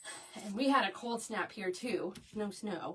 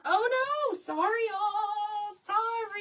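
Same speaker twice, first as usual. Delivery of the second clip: drawn-out apology; 10 semitones higher, 4 dB louder, slower than usual. Real, not acted. Apologizing that kits sold out.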